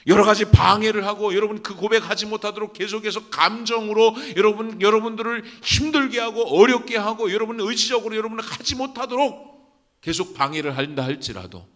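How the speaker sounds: high, loud exhortation; full-force preaching. Real, not acted. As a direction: drive it home to the congregation at the top of your voice. (힘차게 외치는 설교)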